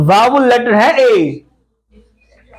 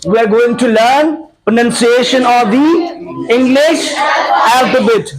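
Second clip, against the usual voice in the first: slow lesson title; much slower than usual. Shown as a demonstration. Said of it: "So what are we gonna do".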